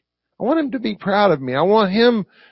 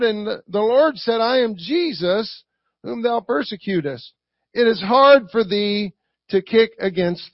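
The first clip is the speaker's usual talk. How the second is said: higher, deliberate reading voice; scripture reading; 4 semitones higher than usual. Acted. Preacher reading Saul's encounter with Jesus, scripture reading. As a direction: proclaim the verses slowly to the room.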